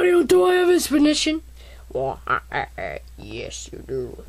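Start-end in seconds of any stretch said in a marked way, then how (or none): 1.9-4.2 much lower, quiet rumble; very deep voice. Acted. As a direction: drop it very deep. Deep voice answers.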